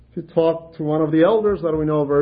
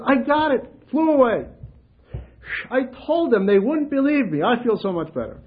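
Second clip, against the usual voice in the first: raised, delighted relief; high relieved voice; 9 semitones higher than usual. Retold or imitated. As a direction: sigh of triumph for a man cured. Relieved man.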